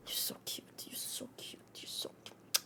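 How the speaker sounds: soft breathy whisper. Real, not acted. Owner whispers to her cat how cute he is, whispered. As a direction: whisper it like a secret to him.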